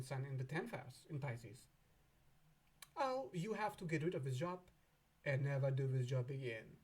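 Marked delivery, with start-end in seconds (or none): none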